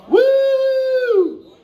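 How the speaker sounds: very high whooping cheer; high hype whoop; excited woo. Real, not acted. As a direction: whoop to pump up the room. Cheering the crowd.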